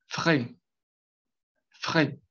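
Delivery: frais with a closed e and a throat R; French word. Shown as a demonstration. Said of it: "la pronunciación de la última sílaba".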